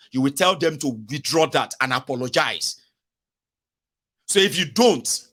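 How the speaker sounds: usual speaking voice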